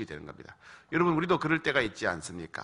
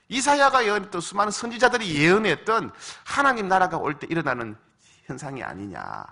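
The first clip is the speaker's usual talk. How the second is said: loud, high preaching as Jesus; fervent preacher voicing Jesus, louder and higher; preaching Jesus' words; 6 semitones higher, 6 dB louder than usual. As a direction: proclaim the miracles at full force. Preaching at full force.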